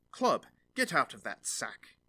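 loud commanding call to the club; cried out. A narrator's reading. Turner catching the thief, shouting at the club, loud. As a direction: bark the order out loud. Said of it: "the turner cried out".

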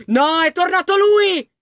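high, loud and slow, stretching "lui"; full alarm on "No, è tornato lui!"; panicked shout. Real, not acted. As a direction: cry out that he is back. Monster returns, panics.

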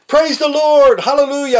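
real praise shout for the Lord; screaming praise. Real, not acted. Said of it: "I'm screaming for the Lord".

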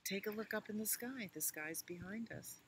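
usual speaking voice

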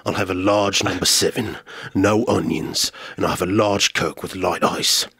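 gruff tough-guy drive-thru order; a hint of a stern action star; Jason Statham. Jason Statham ordering at a drive-thru, gruffly. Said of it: "Jason Statham".

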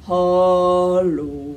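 low sung bear hello; Papa Bear voice. Shown as a demonstration. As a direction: rumble it like a big papa bear. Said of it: "a Papa Bear voice".

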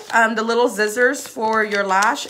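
ordinary delivery

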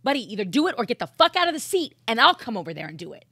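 loud, fast exasperated order; impatient telling-off. Retold or imitated. Host venting at the technician who shuts down, impatient telling-off. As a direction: lay into a slacking colleague, rapid and forceful.

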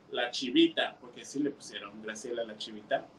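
ordinary delivery, nothing marked

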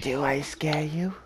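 soft mock-menacing taunt; spooky tease. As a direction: taunt him in a hushed menacing voice. Teasing friend in low voice.

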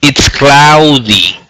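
slow, careful teacher model; deliberate classroom demo. Shown as a demonstration. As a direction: stretch the words out so the student can copy. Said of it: "Fíjate bien, repítelas conmigo".

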